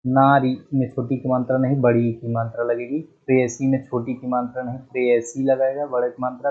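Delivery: usual speaking voice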